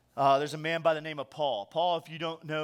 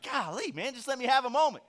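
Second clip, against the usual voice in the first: exasperated plea; 8 semitones higher than usual. Retold or imitated. A parent fed up with a topper, mock exasperated. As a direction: throw up your hands, pleading for a moment.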